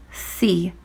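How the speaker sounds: a soft, tender word; quiet, kind healing word. Acted. Jesus healing the blind man, soft and kind. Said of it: "His voice was soft and kind".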